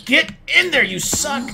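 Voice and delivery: high, loud exasperated yell; shouting in frustration; frustrated shout. Real, not acted. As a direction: yell at the stubborn cow. Shoving cow into pen.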